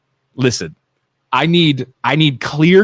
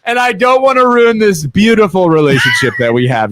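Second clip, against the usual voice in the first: much higher, louder mock groveling apology; hammed-up pleading husband; 13 semitones higher, 5 dB louder than usual. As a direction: overact the sorry husband begging forgiveness. Voicing himself pleading to wife.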